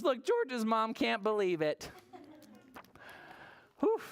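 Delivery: plain reading voice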